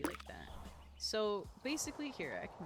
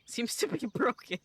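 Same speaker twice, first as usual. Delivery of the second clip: laughing, higher and louder; 6 semitones higher, 7 dB louder than usual. Real, not acted. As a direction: break into a laugh mid-line. Repeats, laughing.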